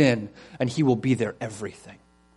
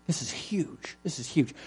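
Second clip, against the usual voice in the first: hushed, voiceless whisper; awed whisper; 8 dB quieter than usual. Real, not acted. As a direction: whisper it like a momentous secret. Preacher hushes for the big point.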